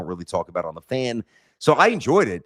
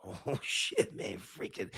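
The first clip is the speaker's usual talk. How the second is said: breathless whispered swearing, half laughing; stunned whisper; 14 dB quieter, slower than usual. Retold or imitated. Hearing his own speech replayed, a shocked whisper. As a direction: whisper it to yourself in disbelief. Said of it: "and I'm like".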